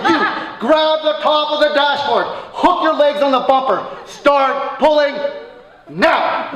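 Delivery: loud, high orders like a drill sergeant; commanding shout. Retold or imitated. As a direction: bark the orders at the boys. Barking orders at the boys.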